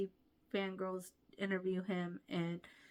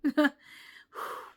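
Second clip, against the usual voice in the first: emotional sigh; 8 semitones higher, 10 dB louder than usual. Real, not acted. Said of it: "that already just made me".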